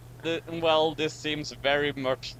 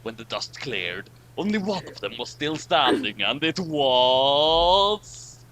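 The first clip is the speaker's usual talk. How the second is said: dragged-out buildup; 2 semitones higher, 4 dB louder, slower than usual. Shown as a demonstration. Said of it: "drag that out a bit more".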